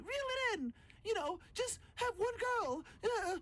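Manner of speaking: mocking woman's voice. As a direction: pitch way up to parody her telling you to settle. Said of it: "the audacity to try to tell you".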